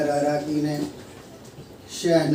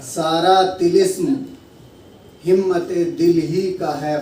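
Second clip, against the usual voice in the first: measured mushaira delivery, lines repeated with more lift; Urdu ghazal recitation; 3 semitones higher, 6 dB louder than usual. Acted. Poet reciting a ghazal to an appreciative gathering, declaimed. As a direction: recite each phrase with weight, pausing for praise.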